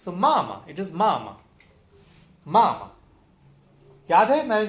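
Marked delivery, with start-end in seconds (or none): 0.1-0.7 mama with a loud first मा, weak second; mama strong at the start, dropping off; first-syllable stress. Shown as a demonstration. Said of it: "उसी को हम जोर से बोलेंगे". 0.9-3.0 each mama hit on its first मा, second short; mama falling from a strong first syllable; first-syllable stress. Shown as a demonstration. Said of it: "उसी को हम जोर से बोलेंगे".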